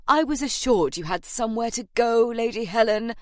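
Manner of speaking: exasperated official reminder. A narrator's reading. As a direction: press her, out of patience. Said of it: "said in exasperation, adding".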